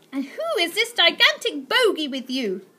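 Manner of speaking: high, loud haughty demand; demanding aunt. Acted. Aunt Greta questioning the boy, high and haughty. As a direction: demand it with disdain. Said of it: "demanded Aunt Greta".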